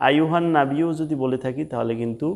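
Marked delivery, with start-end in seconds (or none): 0.1-0.9 hurried "আইয়ুহান নাবিয়্যু" as ayuhan-nabiyo; ya left unpronounced. Shown as a demonstration. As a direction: slide through "আইয়ুহান নাবিয়্যু" with single short y. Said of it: "ইয়ার উচ্চারণটা হচ্ছে না". (ইয়া বাদ দিয়ে ভুল উচ্চারণ)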